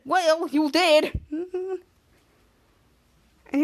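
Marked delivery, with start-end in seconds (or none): 0.0-1.2 sulky Schwaz. Retold or imitated. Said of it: "Cut to Schwaz said".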